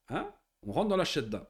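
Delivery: normal talking voice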